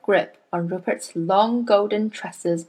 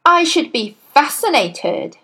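higher, louder mock interest; dripping sarcasm; 7 semitones higher, 4 dB louder than usual. A narrator's reading. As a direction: sneer it with fake interest. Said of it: "in a voice dripping with sarcasm".

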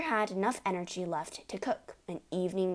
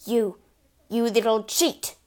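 louder, sudden accusing shout; 3 semitones higher, 8 dB louder than usual. Acted. Father suddenly shouts an accusation at Matilda. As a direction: hurl the accusation. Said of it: "the father suddenly shouted".

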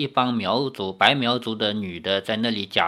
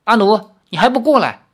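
louder, higher scolding summons; scolding call; 9 semitones higher, 7 dB louder than usual. A narrator's reading. Gai Luojiao scolding Anu to come over, sharp call.

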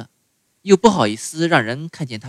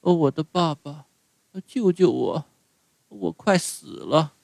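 quiet, halting dying plea; broken, faltering; 5 semitones higher, 6 dB quieter than usual. A narrator's reading. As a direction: gasp it out in broken pieces. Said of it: "断断续续地说".

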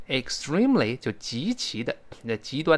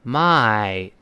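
MY drawn out with a falling tone; stressed MY; 3 semitones lower than usual. Shown as a demonstration. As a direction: stretch MY and let it fall, read aloud for learners. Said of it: "我们大声来读一下这个字".

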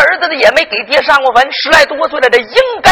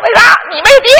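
high-pitched jeer from a playmate; childish taunt; 9 semitones higher, 3 dB louder than usual. Acted. 伙伴嘲他没爹. (小孩尖声嘲弄)

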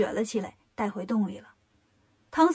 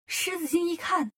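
usual speaking voice

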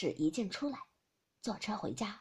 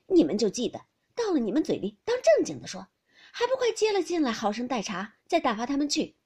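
laughing scold; 3 semitones higher, 8 dB louder than usual. Acted. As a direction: scold the girls without real anger. Youshi scolding maids laughingly.

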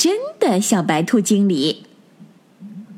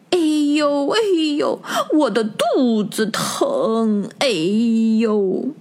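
mock pain groans; 2 semitones higher, slower than usual. Mother rabbit faking a bellyache to test the rabbits, hammed-up moans. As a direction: pretend bellyache moans, stretched slow. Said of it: "捂着肚子叫起来".

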